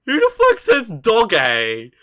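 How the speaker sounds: loud, disbelieving shout; incredulous shout. Real, not acted. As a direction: yell it in disbelief. Repeats louder in disbelief.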